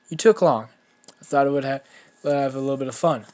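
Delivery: put-on loud, cheeky boy after a fight; loud, unrepentant banter; cocky greeting. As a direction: call out breezily, pleased with yourself.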